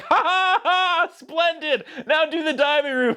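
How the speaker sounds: high, slow hammed-up old man chuckling with glee; mock gleeful grumpy old man won over; delighted old man. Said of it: "He's like".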